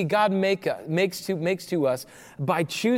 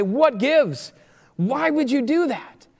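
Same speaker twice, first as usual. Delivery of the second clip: raised-pitch mock exasperation; exasperated demand; 7 semitones higher, slower than usual. Preacher voicing Israelites questioning Moses and God, exasperated. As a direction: demand an explanation, acting affronted.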